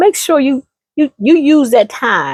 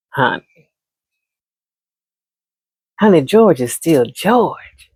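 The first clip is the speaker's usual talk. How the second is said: lower, slow, heavy honey; knowing older friend; 4 semitones lower, slower than usual. Acted. Wise friend setting her straight, lower and slow. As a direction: lay it down like a wise auntie.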